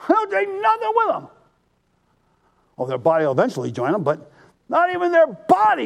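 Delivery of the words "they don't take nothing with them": preacher's high-pitched punchline; high emphatic punchline. Acted.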